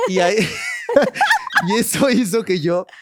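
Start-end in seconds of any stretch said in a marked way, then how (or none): 0.4-1.6 very high, shrieking real laugh; high squeal of laughter. Real, not acted. Shrieking with laughter while confessing to friends.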